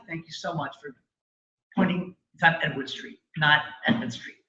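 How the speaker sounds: hushed, halting mock gratitude; muted polite thanks. Storyteller thanking someone for a correction, muted politeness.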